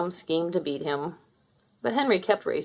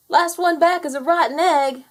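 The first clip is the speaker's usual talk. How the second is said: high, loud taunting challenge; Henry's challenge; 9 semitones higher, 5 dB louder than usual. Acted. Henry dares Fowler to a race, high and loud. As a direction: dare your brother to race. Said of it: "said Henry".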